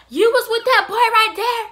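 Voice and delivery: high, loud scolding shout; accusing angry mom. Retold or imitated. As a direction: imitate a mother yelling an accusation. Mom catches her, retold.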